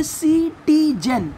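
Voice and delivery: "सिटीजन" slowly, in three beats; split into syllables. Shown as a demonstration. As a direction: beat out each syllable of "सिटीजन". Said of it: "जैसे हम pronounce कर रहे हैं".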